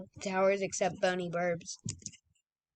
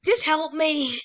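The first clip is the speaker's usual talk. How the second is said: high, loud desperate plea; pleading shout; 6 semitones higher, 6 dB louder, slower than usual. Real, not acted. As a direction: beg for help loudly. Downed, pleading.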